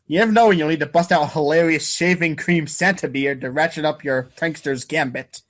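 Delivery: usual speaking voice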